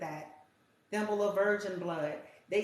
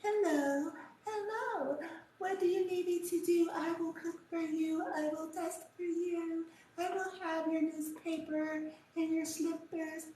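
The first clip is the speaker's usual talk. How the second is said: higher sugary mock-innocent voice; fake sweet girl; 10 semitones higher than usual. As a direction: play a syrupy fake sweetheart, mocking her. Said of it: "They say".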